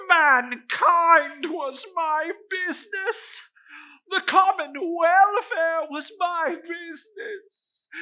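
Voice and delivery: anguished ghostly howl; wailing lament. Acted. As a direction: cry it out like a tormented ghost.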